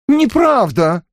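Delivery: высоко и резко, с возмущением; возмущённо вскрикнуть, отрицая; возмущённый возглас. A narrator's reading. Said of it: "возмущённо воскликнула Мариэлла". (возмущённо восклицает, отвергая обвинение Вульфа)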